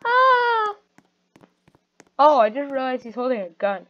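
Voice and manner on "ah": high scream. Acted. Seeing a gun.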